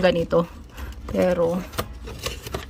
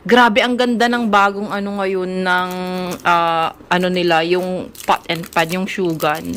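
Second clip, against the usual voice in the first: normal talking voice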